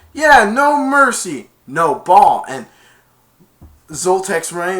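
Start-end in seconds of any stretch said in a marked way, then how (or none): 0.1-2.4 loud, slow taunt; taunting shout. Retold or imitated. Player gloating after the tackle, loud and slow. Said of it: "he's like".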